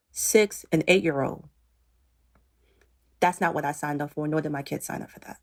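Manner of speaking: soft, near-whispered retelling of a plea; hushed tearful plea. Retold or imitated. Retelling what she told Eric in the car, hushed and tearful. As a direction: quietly pour it out as if still in tears.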